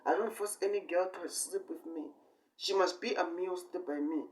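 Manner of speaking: usual speaking voice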